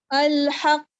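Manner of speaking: الحق with the doubled ق hit hard and loud and bounced; strongest qalqalah. Shown as a demonstration. Teacher demonstrating emphasis on the shadda, loud strongest qalqalah. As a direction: shake the final ق of الحق with full force. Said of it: "strongest قلقلہ کرنا ہے".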